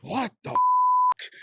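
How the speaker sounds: high, loud, drawn-out shocked cry; a startled shriek that stretches out; panicked high shriek, slowed right down. Retold or imitated. Retold panic when eyes burn.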